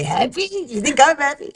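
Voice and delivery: mock the family catchphrase like bubby; Yiddish accent. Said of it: "we say".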